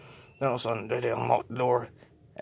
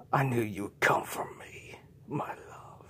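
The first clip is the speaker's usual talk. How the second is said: seductive vampire whisper. Acted. Vampire countess greets J J.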